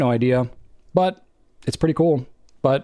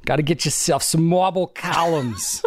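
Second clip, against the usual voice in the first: slow, laughing Long Island sketch voice; SNL Long Island accent; 4 semitones higher, slower than usual. Retold or imitated. Quoting an SNL skit to a co-host, slow and laughing in a Long Island accent. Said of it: "It's an S N L skit".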